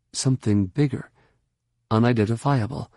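usual speaking voice

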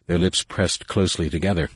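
ordinary delivery, nothing marked

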